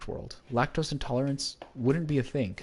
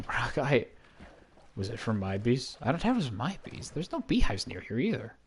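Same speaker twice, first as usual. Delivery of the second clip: ordinary delivery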